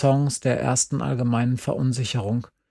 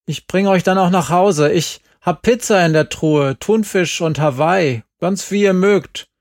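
loud, higher, eager invitation; called out; 5 semitones higher, 5 dB louder than usual. A narrator's reading. Lonely father calls loudly, begging them to stay for dinner. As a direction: call it across the yard, pleading a little. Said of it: "rief er".

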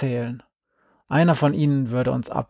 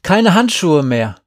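raised, loud triumphant call; excited calling out; 5 semitones higher, 8 dB louder than usual. A narrator's reading. Girl reports back to her friends, excited call. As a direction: shout the good news onward. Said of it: "rief sie".

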